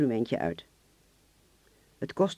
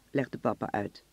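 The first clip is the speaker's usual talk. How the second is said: usual speaking voice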